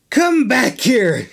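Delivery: slow, strained growl; a touch of a growl. Acted. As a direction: drag it out with all his strength. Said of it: "he growled".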